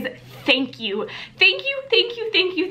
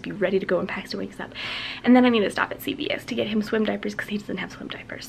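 whispering; 5 semitones lower, 6 dB quieter, faster than usual. Real, not acted. Baby napping, filming quietly.

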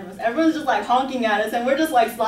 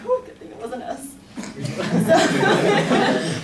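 relieved laugh through the words; 6 semitones higher, 4 dB louder, much slower than usual. Real, not acted. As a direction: laugh it off, glad it wasn't us. Laughs after near miss.

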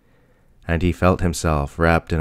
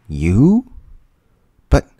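high, loud shocked cry, voice thrown up; 8 semitones higher, 6 dB louder, slower than usual. Acted. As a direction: blurt it out in disbelief at the creature. Fermi stunned, stammering.

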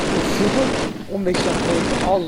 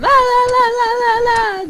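much higher, loud sing-song "la la"; my own yelling; 8 dB louder, slower than usual. Retold or imitated. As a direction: belt the "la"s half-singing. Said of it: "Bir taraftan ben bağırıyorum".

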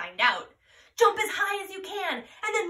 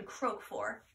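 whisper; 4 semitones lower, 10 dB quieter than usual. Acted. Wondering aloud to the children.

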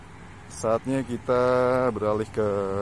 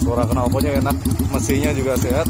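raised voice over engine noise; shouting over wind; 4 semitones higher, 9 dB louder than usual. Real, not acted. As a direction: speak up over the wind and motor. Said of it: "Anginnya kenceng banget".

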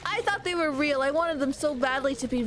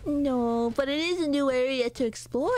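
ordinary talk

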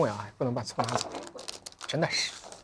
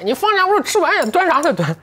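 sudden high, raised-voice outburst; calling out in protest; 4 dB louder than usual. Real, not acted. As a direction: protest loudly as the food is taken away. Sudden protest over food taken away.

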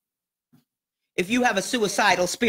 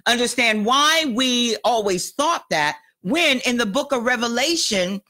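ordinary talk, nothing marked

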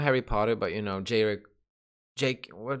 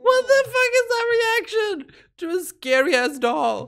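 much higher, squealing in disbelief; excited squeal; 4 dB louder than usual. Real, not acted. Fan squealing at his reaction to the doll. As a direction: shriek it half-laughing at the clip.

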